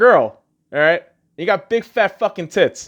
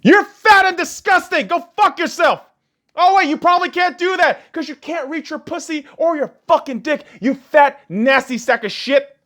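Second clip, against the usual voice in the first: angry insults; 9 semitones higher than usual. Real, not acted. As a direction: tear into them. Ranting insults at imagined person.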